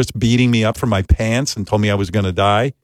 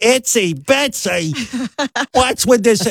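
much higher, shrill-free mock screaming mother; mock angry mom; 12 semitones higher, slower than usual. Mom confronting her son about his reading, mock yell higher. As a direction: play the outraged mother.

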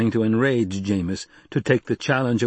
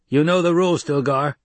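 raised, hard voice pressing the point; a fighter demanding his rights, raised and hard; forceful challenge; 7 semitones higher, 5 dB louder than usual. Acted. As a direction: confront him, louder and higher. Jameis challenging Stilgar.

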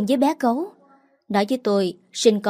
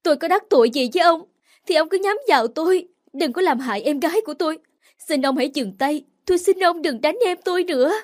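crying plea; 7 semitones higher, faster than usual. Acted. Sister begging the kidnapper in tears to stop. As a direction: plead with the kidnapper through tears. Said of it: "Tôi vừa khóc vừa nói".

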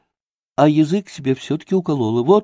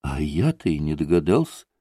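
sorrowful exclamation; 10 semitones lower, 6 dB quieter than usual. A narrator's reading. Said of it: "горестно воскликнул Смуров".

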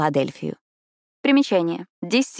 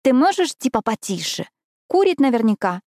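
higher-pitched, mildly irritated hushing; displeased; 7 semitones higher than usual. A narrator's reading. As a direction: tell her off with mild annoyance. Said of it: "недовольно начала Эшли".

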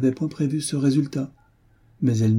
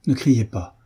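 plain reading voice

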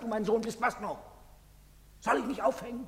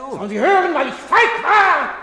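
anguished cry; 12 semitones higher, 13 dB louder than usual. Acted. Father cries out his cowardice to the town. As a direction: cry out the shame.

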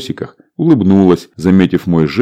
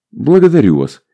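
routine polite smile; 7 semitones higher than usual. A narrator's reading. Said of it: "дежурно улыбнулась стюардесса".

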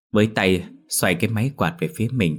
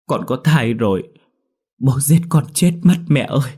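higher, louder, distraught confession; desperate wail; 6 semitones higher, 5 dB louder than usual. A narrator's reading. Daughter dreading her father's fury, wailing to her mother. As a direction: cry it out in dread.

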